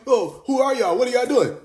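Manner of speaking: lightly ape a suspicious stranger; mild mock of a prying outsider; mock questioner. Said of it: "When motherfucker asks you".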